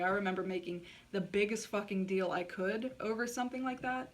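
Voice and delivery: usual speaking voice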